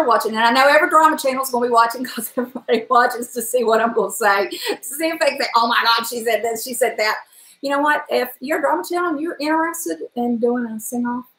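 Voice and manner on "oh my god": ordinary talk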